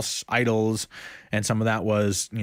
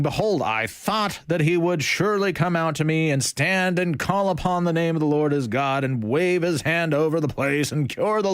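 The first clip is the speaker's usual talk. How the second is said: raised, earnest Bible reading; scripture reading; 4 semitones higher than usual. Acted. Preacher reading the angry general's words to the group, lifted and earnest. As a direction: read Naaman's complaint with some heat.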